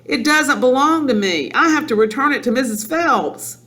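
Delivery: put-on high, urgent child's protest; crying out. A narrator's reading. Girl begging her father to spare a library book, crying out. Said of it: "Matilda cried".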